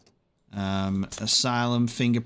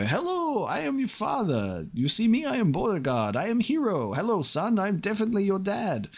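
much higher, hammed-up fake father voice; fake body double dad; 9 semitones higher than usual. Impostor body double greeting the guard's son, hammed-up high voice. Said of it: "replace me with a body double and go".

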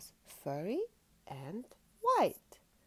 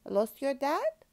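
loud, higher question rising at the end; wobbly walrus; 7 semitones higher, 8 dB louder than usual. A narrator's reading. Walrus asking the lost cub, loud and higher. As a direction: ask it as a storybook walrus. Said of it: "wobbled Walrus".

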